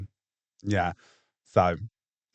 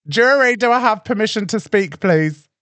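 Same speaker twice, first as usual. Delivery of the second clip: loud; 8 semitones higher, 6 dB louder than usual. Real, not acted. Repeats demand to be heard.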